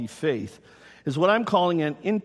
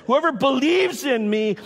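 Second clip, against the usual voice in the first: raised, high preaching voice; ringing public declaration, pitched up; loud proclamation; 8 semitones higher, 4 dB louder than usual. Acted. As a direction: call it out to a whole crowd. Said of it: "said in a loud voice".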